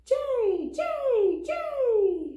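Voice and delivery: high, piercing jay cries; blue jay shriek. A narrator's reading. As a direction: shriek it sharp as a drill. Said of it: "Blue Jay's shriek is sharp as a drill".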